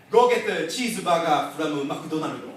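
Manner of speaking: imitated Japanese accent with extra syllables; father's Japanese accent. Father telling his hungry child to get food, Japanese accent. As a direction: add vowels like a Japanese English speaker. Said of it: "He'll add extra syllables to his sentences".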